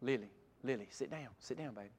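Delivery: softly telling Lily to sit; quiet aside. Real, not acted. Aside to a child mid-sermon.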